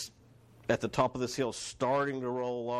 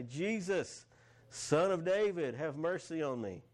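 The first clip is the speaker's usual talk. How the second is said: higher-pitched mild call, not a full shout; crying out; 6 semitones higher, slower than usual. Acted. Blind beggar to Jesus on the roadside, crying out. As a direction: plead upward in a restrained scripture-reading cry. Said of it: "he began to cry out".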